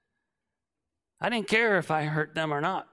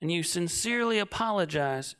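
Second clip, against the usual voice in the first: ordinary talk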